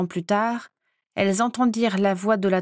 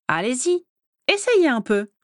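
higher, gleeful taunt; delighted dare; 4 semitones higher than usual. A narrator's reading. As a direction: egg them on, pitched up. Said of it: "ravie de la tournure". (défi ravi, voix plus haute)

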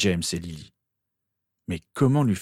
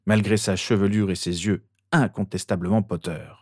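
ordinary delivery

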